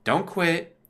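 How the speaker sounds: ordinary talk, nothing marked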